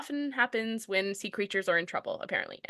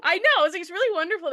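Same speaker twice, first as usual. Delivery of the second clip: excited; 8 semitones higher, 5 dB louder, faster than usual. Real, not acted. Matching excited joke.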